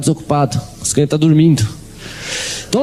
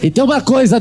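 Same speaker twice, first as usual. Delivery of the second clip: usual speaking voice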